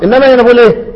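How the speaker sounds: usual speaking voice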